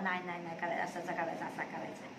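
put-on soft, low, mumbled toddler talk; little Yash's babble. Mother imitating her little son's mumbled reply, softly. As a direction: mumble it like a small child barely talking. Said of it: "एवढं बोलत नसायचं यश".

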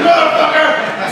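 high, loud, slow drawn-out curse; furious curse. Retold or imitated. Training partner cursing after being caught twice. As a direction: stretch it out in fury. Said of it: "He's like".

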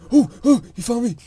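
loud, high panicked oh; suddenly jumped and frightened; startled alarm. Real, not acted. Gamer ambushed by a mob, loud alarmed cry.

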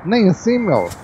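high, loud exasperated cry; frustrated exclamation. Real, not acted. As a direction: cry out as the move fails. Parking goes wrong.

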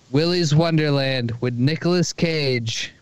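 ordinary delivery

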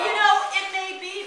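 laughing back at the heckle; high, loud amused retort. Real, not acted. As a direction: play along, still laughing. Laughing reply to teasing.

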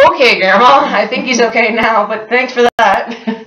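high, loud, humouring retort to grandma; mock awkward thanks. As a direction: humour her loudly in a high voice. Retold reply to grandma's blunt warning.

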